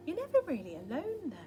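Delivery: plain reading voice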